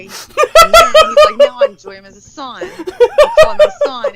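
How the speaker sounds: laughing hard through a mocking quote. Real, not acted. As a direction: crack up mid-sentence.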